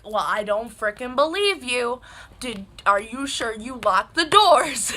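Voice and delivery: loud, emphatic retold challenge; insistent disbelief. Daughter doubting mom locked the doors, loud and insistent. Repeats question, no longer believing mom.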